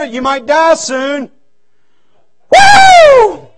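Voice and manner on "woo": high, loud falsetto whoop; a wild surprised yelp; mock startled whoop. Preacher retelling the doctor's warning, high loud whoop.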